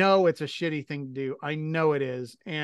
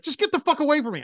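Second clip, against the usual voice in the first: fast, much higher, louder mock yell; imitated furious yell; 11 semitones higher, 5 dB louder, faster than usual. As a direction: rattle off her angry order, shouting. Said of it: "she's like".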